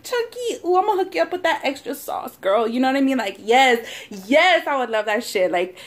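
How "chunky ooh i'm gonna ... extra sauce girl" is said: much higher, fast, fawning server voice; gushing fan server. Pretend. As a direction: gush like a starstruck restaurant worker. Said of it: "and they're like".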